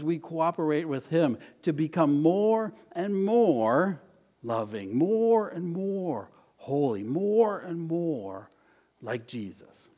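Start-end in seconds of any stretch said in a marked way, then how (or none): 2.2-5.0 very slow, higher, stretched words; slow, lingering preacher's build; drawn-out refrain. Real, not acted. Preacher stretches his repeated refrain. 5.0-9.9 slow, swooping, stretched words; lingering, rhythmic preacher's emphasis; drawn-out refrain. Real, not acted. Preacher stretches his repeated refrain.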